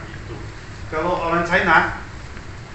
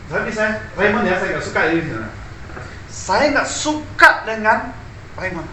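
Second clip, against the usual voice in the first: mock polite complaint; 6 semitones higher than usual. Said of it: "ini begini caranya".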